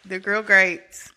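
slow, deliberate GRILL GRATES; composed retake. Real, not acted. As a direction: say GRILL GRATES slowly, holding back the laugh. Composed retake after cracking up.

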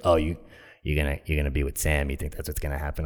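low, soft skeptical taunt; imitating the forward stranger, doubting and suggestive; seductive woman. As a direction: needle them in a deep, quiet voice. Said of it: "just that kind of voice".

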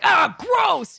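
loud high disgusted cry; disgusted shout. Retold or imitated. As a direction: yell it in disgust. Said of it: "people got really like".

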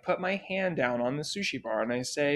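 ordinary delivery

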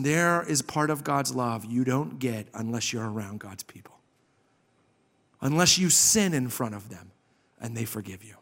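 normal talking voice

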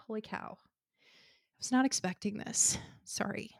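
tearful, voice catching, then whispered; fighting back real tears; choked up. Real, not acted. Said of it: "I'm getting really choked up".

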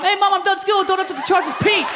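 cram it all into the beep; rushed collect-call yell. Retold or imitated. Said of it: "There was that prank collect call".

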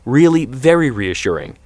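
lightly sarcastic father. A narrator's reading. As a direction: lay on the false praise a little. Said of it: "David said sarcastically".

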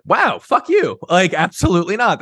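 high-pitched mock outrage, pushing him off; indignant rebuff. Retold reply to unwanted kiss.

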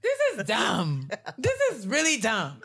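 high, emphatic dismissal; high-pitched incredulous verdict; amused exasperation. Real, not acted. Exasperated verdict amid laughing fit.

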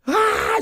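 very high imitated sob; a sharp tearful cry, mimicked; sobbing wail. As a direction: act out her crying even harder. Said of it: "llorando peor".

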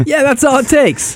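high, loud ironic quip; mock sarcastic. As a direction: toss it off with heavy irony. Joking reply about control.

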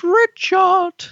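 drawn-out, trilling call of a sitcom wife; shrill catchphrase call. Acted. Speaker mimicking the Keeping Up Appearances catchphrase, shrill call. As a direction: sing out the name, high and loud.

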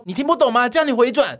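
high, loud mock scolding; loud high exasperated order; impatient demand. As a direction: snap at the driver like he's deaf. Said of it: "他就说".

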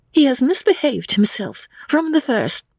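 put-on high-pitched, indignant young lady; indignant accusation. Lucy accuses George to his father, indignantly.

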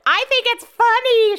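high, loud teasing squeal; mock teasing yell. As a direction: imitate a sister-in-law gleefully teasing, high and loud. Retelling sister-in-law's teasing at the bar.

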